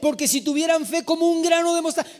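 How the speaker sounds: mock-indignant disbelief; incredulous protest. Voices incredulous protest at the verse.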